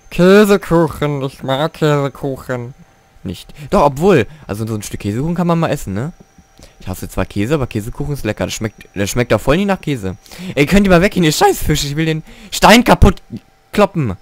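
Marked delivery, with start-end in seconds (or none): 0.1-2.7 slow savoring. Real, not acted. Musing on cheesecake, drawn-out. 10.5-14.2 loud, higher, fed up with the fish; annoyed yell at fish. Real, not acted. Gamer yelling at in-game fish, annoyed shout.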